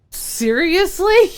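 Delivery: high, drawn-out mock disbelief; incredulous. Streamer reacting to a really simple fix, incredulous.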